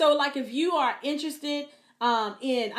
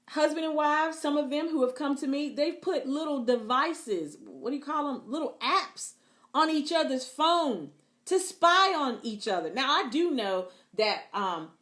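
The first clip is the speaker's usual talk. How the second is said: usual speaking voice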